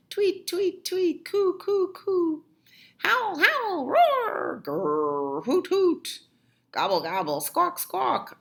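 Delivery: noisy zoo imitations; animal noises. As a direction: make each animal sound for the kids.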